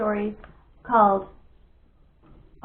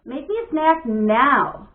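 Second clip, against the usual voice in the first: insistent; 2 semitones higher, 7 dB louder, much slower than usual. Acted. Bat insisting.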